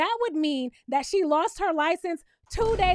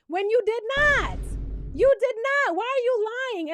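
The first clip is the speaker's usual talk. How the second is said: calling her out; 6 semitones higher than usual. Real, not acted. Host calling out Britt.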